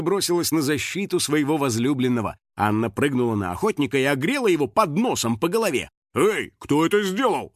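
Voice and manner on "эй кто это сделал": higher, mildly outraged demand; indignant. A narrator's reading. Struck hunter demands the culprit, indignant. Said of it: "возмутился он".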